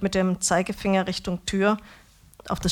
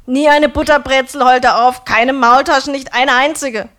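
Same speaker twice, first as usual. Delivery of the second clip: loud and higher, aggrieved; loud lament; 5 semitones higher, 12 dB louder than usual. A narrator's reading. Döner man complaining to the narrator, loud and aggrieved. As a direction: wail it out like a grievance.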